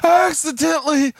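put-on high, whiny child voice; confessing little daughter. Daughter confessing to somebody, high childlike voice. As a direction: imitate a small girl owning up. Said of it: "She runs up to somebody and says".

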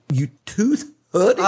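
high mock Japanese accent; high-pitched put-on Japanese receptionist. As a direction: repeat the punchline in imitation Japanese. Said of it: "a Japanese receptionist".

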